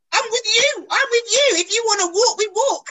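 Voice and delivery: hammed-up ally, high and loud; imitating Gary. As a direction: pump it up like a pal backing you all the way. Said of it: "He said".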